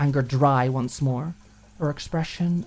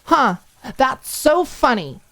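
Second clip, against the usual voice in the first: slow, much higher, louder sneer; slower sarcastic repeat; dry sarcasm; 9 semitones higher, 6 dB louder, slower than usual. Acted. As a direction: drag it out, mocking. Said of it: "scrubbing at it furiously".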